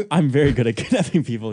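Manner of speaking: quoting through laughter; amused quoting. Retold or imitated. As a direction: repeat the absurd line, laughing. Amused requote of the email.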